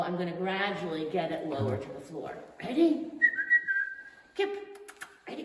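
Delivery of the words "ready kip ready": much higher, louder, eager call; excited dog praise. Real, not acted. Trainer calling her dog Kip to play, high and excited.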